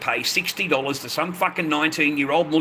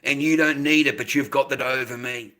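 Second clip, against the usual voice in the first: ordinary delivery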